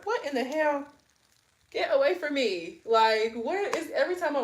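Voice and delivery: sudden high startled yelp; startled outburst. Real, not acted. Reader startled by something at the window, shrieking. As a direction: jump back and shoo it off.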